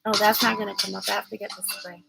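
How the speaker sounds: usual speaking voice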